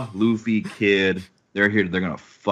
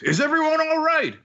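very high, sustained calling voice; mock shout. Samurai checking on the crew, high mock shout. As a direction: call across to them, pitched way up. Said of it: "Kinemon shouts".

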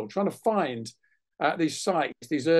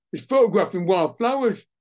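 loud, higher-pitched imitation of a workman; raised voice, playing the scandalised workman; mock indignant report; 4 semitones higher, 6 dB louder than usual. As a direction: report it to the boss in acted outrage.

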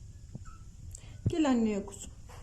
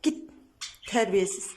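ordinary talk, nothing marked

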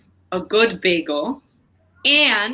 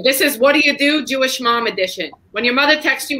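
usual speaking voice

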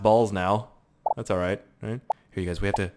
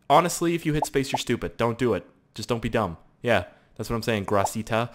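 normal talking voice

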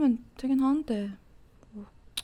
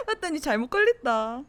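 teasing laugh; 4 semitones higher than usual. Acted. Teasing the friend after a promise.

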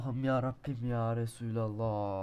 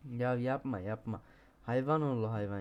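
usual speaking voice

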